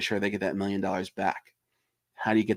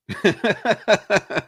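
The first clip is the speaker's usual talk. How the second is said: short higher laugh after ranting; real laugh; 9 semitones higher, 4 dB louder than usual. Real, not acted. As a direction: laugh off the rant. Laughing at own rant.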